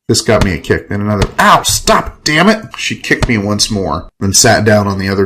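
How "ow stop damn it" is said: high, yelping objection; pained protest. Acted. Man kicked by Ava, pained yelp. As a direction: yelp it while being kicked.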